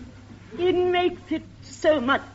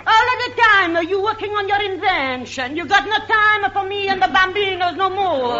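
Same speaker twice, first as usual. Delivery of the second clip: put-on Italian accent; 3 semitones higher than usual. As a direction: scold him in a comic Italian accent. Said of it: "I played Missus Julia Momarcone".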